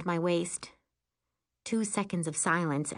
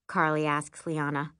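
plain reading voice

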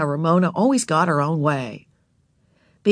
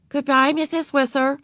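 polite; 8 semitones higher than usual. Acted. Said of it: "said Beezus politely".

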